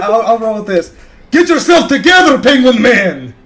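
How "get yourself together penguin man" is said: shouting. Acted. Rallying a fallen teammate.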